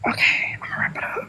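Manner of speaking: whispered, shrinking away; embarrassed whisper. Real, not acted. Guest embarrassed after the host's reveal, whispering. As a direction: whisper it, wanting to disappear. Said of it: "embarrassed, mumbles".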